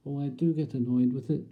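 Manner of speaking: normal talking voice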